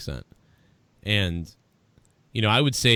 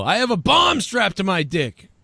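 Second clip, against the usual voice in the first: higher, slower, half-hearted yell; mock yell; 10 semitones higher, 4 dB louder than usual. Joking about yelling in a convenience store, a half-hearted mock yell. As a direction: pretend to yell the prank line. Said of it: "run into a convenience store and yell".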